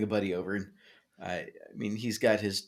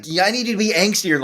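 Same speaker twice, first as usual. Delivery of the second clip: high, loud push for more angst; excited demand; 10 semitones higher, 9 dB louder than usual. Retold or imitated. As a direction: egg them on, louder. Producer coaching voiced.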